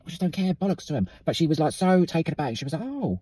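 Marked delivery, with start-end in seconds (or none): 2.8-3.2 a high, startled little oh; surprised oh. Retold or imitated. Retelling how a stranger reacted to a compliment, startled high oh. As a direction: imitate the woman caught off guard.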